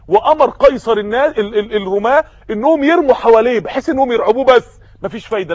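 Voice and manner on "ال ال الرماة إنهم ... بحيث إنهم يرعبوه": usual speaking voice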